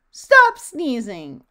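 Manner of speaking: loud, slow, forceful command; bossy order. A narrator's reading. Little Miss Bossy scolds Mister Sneeze, loud bossy order. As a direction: order it loudly, stretching the words. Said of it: "ordered Little Miss Bossy".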